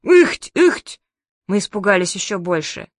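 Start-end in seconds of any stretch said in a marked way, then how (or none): 0.0-1.0 two loud, high grunts; sharp double heave of effort; imitated grunts. A narrator's reading. Grandma heaving harder behind the door, imitated grunts. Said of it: "Потом ещё раз".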